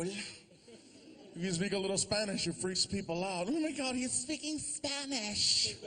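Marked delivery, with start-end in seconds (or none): 3.5-5.7 gasping mock alarm, slow; mock shocked onlooker. Mock alarmed listener hearing Spanish, slow.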